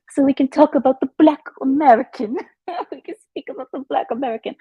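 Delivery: high, lilting mock gossip; gossiping posh Brit. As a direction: play a catty English lady.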